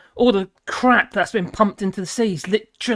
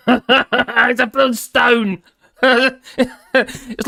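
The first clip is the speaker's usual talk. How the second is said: slow words broken up by real laughter; amused laughing aside to chat; 4 dB louder, much slower than usual. Real, not acted. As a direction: giggle through the joke about the stone. Laughing at chat mocking his stone.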